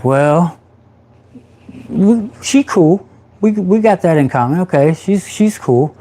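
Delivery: a pleased inner nod at a kindred spirit; sizing her up. Retold or imitated. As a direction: inner thought noticing her tattoos.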